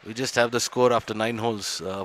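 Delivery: ordinary talk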